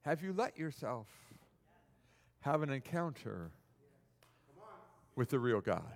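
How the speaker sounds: soft, low, with long pauses; solemn searching question. Real, not acted. Preacher asks the congregation a searching question, soft and solemn. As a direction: ask it low and gently, spaced out.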